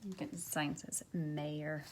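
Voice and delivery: plain reading voice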